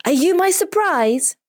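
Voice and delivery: put-on very high child's voice, rising with delight; high-pitched, thrilled question; amazed boy. A narrator's reading. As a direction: ask in joyful disbelief. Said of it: "He jumps into her arms and asks".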